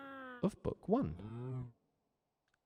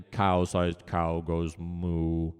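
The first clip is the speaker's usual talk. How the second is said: big cow voice; 5 semitones lower, much slower than usual. Shown as a demonstration. Demonstrating cow sound.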